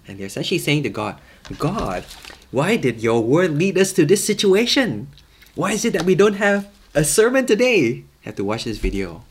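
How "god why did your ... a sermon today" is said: loud, high-pitched mock protest; complaining to God. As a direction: cry out an indignant complaint to heaven. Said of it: "their complaints are going up towards God".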